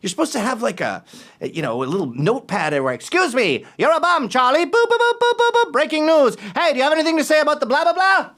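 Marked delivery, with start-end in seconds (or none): 2.9-8.3 high-pitched, hectoring newshound; pushy reporter. Acted. Mock reporter pestering someone for breaking news, high and pushy. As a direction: badger them like a hammed-up reporter.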